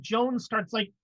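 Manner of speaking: ordinary delivery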